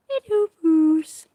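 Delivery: high, slow sing-song hello. Real, not acted. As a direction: coo up high at the cat. Greeting the cat.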